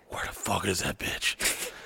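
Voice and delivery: a paranoid late-night whisper; hushed worried whisper. Retold or imitated. Boyfriend alone at night, whispering suspiciously. Waking at 5 am, girl missing.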